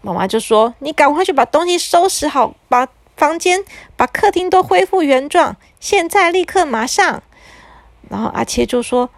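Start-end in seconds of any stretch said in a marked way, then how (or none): 0.8-7.2 stern mother. A narrator's reading. Mother orders boy.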